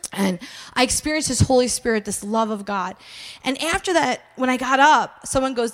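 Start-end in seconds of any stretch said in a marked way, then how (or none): none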